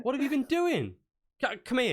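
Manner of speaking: high, fussing parental scolding; exasperated scolding. Acted. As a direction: scold as if to a messy child. Shopkeeper scolds and summons her.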